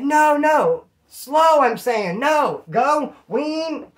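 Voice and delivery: high, loud mock shouting match; acted frantic shouting; retold yelling over noise. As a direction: holler desperately from the back of a motorbike. Retold yelled exchange on motorbike.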